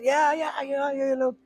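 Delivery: high quick yeahs; eager mock agreement. Narrator plays along with the candidate, high eager yeahs. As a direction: jump in agreeing too eagerly.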